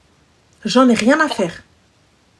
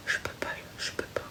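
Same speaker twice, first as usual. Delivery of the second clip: exhausted whisper; 22 dB quieter than usual. Real, not acted. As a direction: barely breathe the words out.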